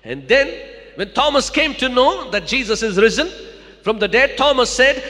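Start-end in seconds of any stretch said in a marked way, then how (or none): none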